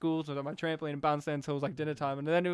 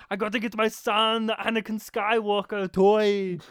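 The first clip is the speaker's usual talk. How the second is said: slow, loud Arnold-style delivery; Schwarzenegger impression; 3 semitones higher, 6 dB louder than usual. Quoting Schwarzenegger's character.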